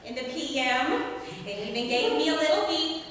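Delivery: hammed-up New Jersey housewife accent, gossiping on the phone; chatty mob wife from New Jersey, a loosely done Jersey accent; a deliberately bad New Jersey accent with giggles. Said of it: "Are you also ready for a bad accent?".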